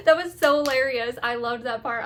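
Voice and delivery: ordinary talk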